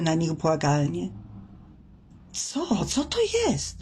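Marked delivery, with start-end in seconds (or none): 2.3-3.8 high, startled, gasping question; a frightened fisherman chokes out the words; choked out in fright. A narrator's reading. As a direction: blurt it out in shock, voice jumping up. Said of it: "wykrztusił jeden z mężczyzn".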